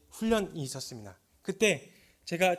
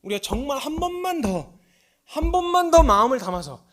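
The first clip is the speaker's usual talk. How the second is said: loud, higher impassioned sermon voice; fervent preaching; 7 semitones higher, 10 dB louder than usual. Acted. Preacher retelling his call to repentance, loud and impassioned. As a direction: preach it with full force.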